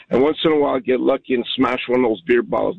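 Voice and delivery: normal talking voice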